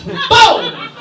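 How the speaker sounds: loud, high victory yell; triumphant shout. Real, not acted. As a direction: shout it like a mic drop.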